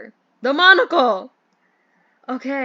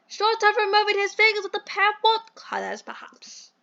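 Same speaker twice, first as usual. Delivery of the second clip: posh British gentleman. Acted. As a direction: play the fussy English employer in a mild posh accent. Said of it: "I hate this accent".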